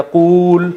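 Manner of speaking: قول drawn out as a loud, long qooool; long vowel. Shown as a demonstration. Teacher shows the imperative just formed, vowel stretched long. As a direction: hold the oo in قول. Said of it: "اب قول اتنا لمبا ہے".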